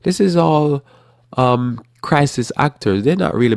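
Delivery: sneering conspiracy theorist calling it fake; mock conspiracy theorist. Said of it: "And the conspiracy theorist says".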